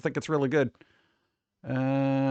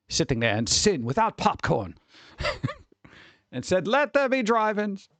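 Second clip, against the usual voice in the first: evangelist preacher; 7 semitones higher than usual. Shown as a demonstration. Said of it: "You gotta say it like an, an evangelist".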